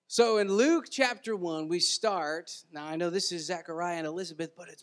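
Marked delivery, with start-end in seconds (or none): none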